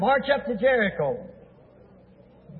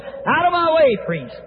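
raised, high-pitched order; brusque command; 5 semitones higher than usual. Acted. Priest clearing his road to Jericho, brusque command.

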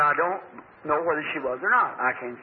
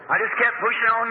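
ordinary talk

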